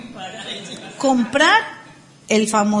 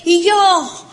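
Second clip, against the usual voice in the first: mock boastful speaker; 6 semitones higher than usual. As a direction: brag like a self-made man.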